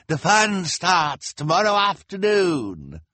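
high, slow villain call; villain crying out. A narrator's reading. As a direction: call out the invitation theatrically. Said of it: "Count Olaf cried".

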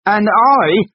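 very high, loud roar; explosive shout; furious bellow. A narrator's reading. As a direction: bellow over your spouse. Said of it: "bellowed Colin".

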